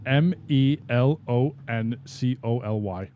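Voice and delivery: M E L O N C O L Y, letter by letter; spelled slowly one letter at a time; spelling it out. Shown as a demonstration. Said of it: "Give me a word".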